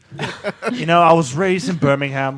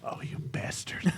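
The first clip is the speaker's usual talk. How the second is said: mock-betrayed whisper; playful whispered curse; 11 dB quieter than usual. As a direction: hiss it under your breath in jest.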